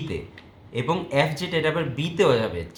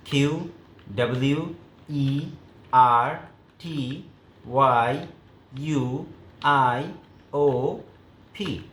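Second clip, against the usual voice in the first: calling out letters; much slower than usual. Shown as a demonstration. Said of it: "মুখে উচ্চারণ করবে".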